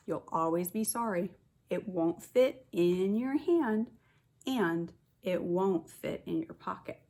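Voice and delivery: normal talking voice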